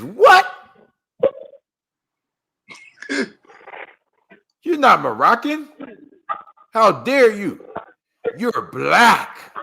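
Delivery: mock the man's outrage at him; hammed-up indignant yell; retold outrage. Angry man confronting him.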